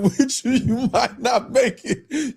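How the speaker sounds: giggling through the words; laughing teasingly at a viewer. Real, not acted. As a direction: tease him while laughing. Teasing Sly in chat, laughing.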